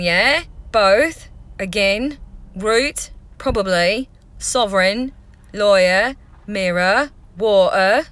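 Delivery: English Essex accent, word by word. Shown as a demonstration. Vlogger showing accents from a UK tour, Essex accent. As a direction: read each word as if from Essex. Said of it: "I'll do, like, Essex first".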